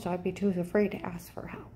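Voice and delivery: hushed, breathy whisper; whispering as if afraid to be overheard; whispered aside. Real, not acted. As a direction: drop to a secretive whisper. Confiding about threats.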